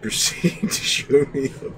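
loud, high laughter through words; real laughing. Real, not acted. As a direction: crack up while telling it. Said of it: "I'm laughing about it bad now".

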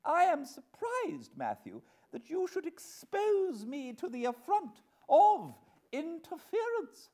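very high, loud wounded dignity; imperious wife. Acted. Wife rebuking husband for the affront, imperious. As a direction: rebuke him with haughty outrage.

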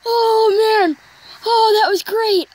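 high and loud, overjoyed; thrilled. Real, not acted. Gamer celebrating his home run, loud thrilled gushing. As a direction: gush about the great hit.

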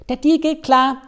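high, loud cartoon hare. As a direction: squeak it out like an excited rabbit. Der Hase spricht, hohe Stimme. (hohe, laute Hasenstimme)

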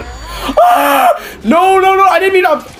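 high startled panic; frightened yelp and pleading; scared horror game streamer. Real, not acted. Startled in a creepy room.

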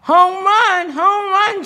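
high-pitched jubilant yell; high, sustained cheer; excited shout. A narrator's reading. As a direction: shout it out like a young player. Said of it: "shouted Froggy".

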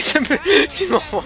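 slow words broken by a real laugh, high up. Real, not acted. Gamer laughing as his character glitches again, high laughing. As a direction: laugh through it in disbelief.

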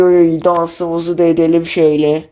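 ordinary delivery

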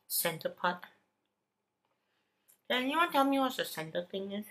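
speaking between bites; talking while chewing. Real, not acted. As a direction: mouth partly full. Seen 1: Holds bitten chocolate cake, chews and bites between phrases throughout.

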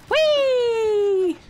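high, squealing cheer; playful whee. Real, not acted. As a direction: cheer like on a slide. Gen flying, playful cheer.

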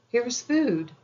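put-on high, loud happy shouts of children; high excited cries over found food; joyful cry. A narrator's reading. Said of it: "they cried".

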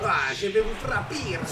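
low put-on fighter voice. Voicing fighter Marco in put-on voice.

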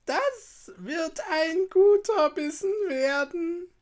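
high, slow, put-on witch voice; witch talking to herself. A narrator's reading. Witch eyeing the sleeping children, gloating to herself. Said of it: "so murmelte sie vor sich hin".

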